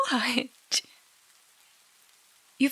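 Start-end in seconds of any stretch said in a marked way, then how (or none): 0.1-0.8 whispered mocking imitation; mock innocent what. Girlfriend whispering a mock of her boyfriend's innocent reply. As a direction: mimic his fake confusion in a whisper.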